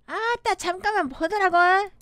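lively Jeolla dialect; 전라도 사투리. Shown as a demonstration. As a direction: call it out like a Jeolla local. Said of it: "전라도". (전라도 사투리로 넉살 있게)

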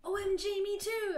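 excited and loud, voice up high; happily. Acted. Reading the fic's heroine meeting Satan, hammed-up excitement. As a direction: gush like a thrilled fangirl. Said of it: "I replied happily".

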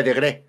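Digrey with the stress on the first E, dee-GREY flattened; Digrey pronounced with the E accented. Shown as a demonstration. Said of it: "Es con acento en la E".

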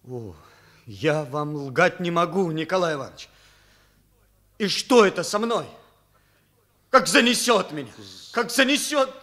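loud, high, despairing plea; anguished outcry. Acted. Boy pleads with his teacher, anguished loud outcry. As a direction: cry out in shame and despair, voice climbing.

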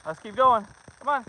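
loud call to keep the hike moving. Real, not acted. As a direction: push the group forward. Urging son onward.

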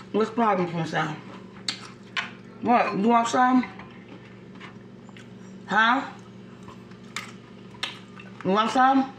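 high, sing-song pet voice; talking to a pet. Real, not acted. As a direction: coo at the dog playfully. Talking to dog in baby voice.

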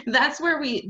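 usual speaking voice

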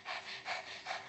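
quick, breathy panting like an overheated dog; soft mimicked panting; imitated dog panting. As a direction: pant like a hot dog. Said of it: "my dog's just there like".

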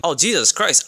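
normal talking voice

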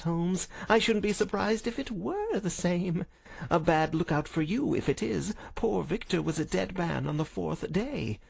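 high, sneering villain voice; gloating visitor Smith. Acted. Visitor taunts Holmes about Victor's death, gloating. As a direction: taunt him with relish.

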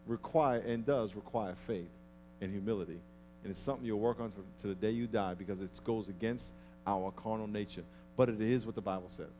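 ordinary talk, nothing marked

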